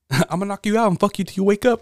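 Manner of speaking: high, quick boxer impersonation; Mike Tyson. Retold or imitated. Co-host riffing as a threatening boxer, high mock menace. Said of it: "like Mike Tyson when he was yelling".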